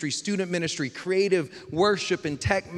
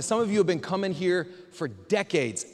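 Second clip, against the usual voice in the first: ordinary talk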